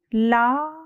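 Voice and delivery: lightly stretched mimic of very slow drool; very slow drip. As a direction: let the words drip out one by one. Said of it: "बहुत धीमी गति से गिरी".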